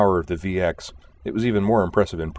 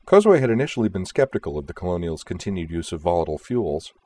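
plain reading voice

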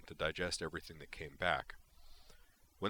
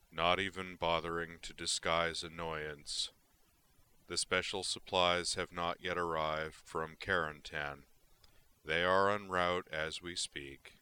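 slow heavy monotone voice; Elcor monotone; 3 dB louder, slower than usual. Acted. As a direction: drone it out slowly, without feeling. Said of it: "his heavy, monotone voice".

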